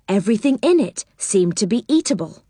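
usual speaking voice

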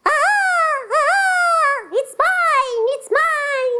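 very high, piercing eagle cry; sustained high bird shriek on each word; screeching eagle. Acted. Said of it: "The eagle".

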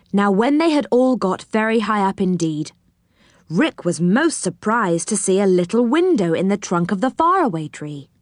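ordinary delivery

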